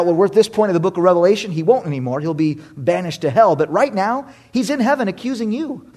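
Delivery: ordinary talk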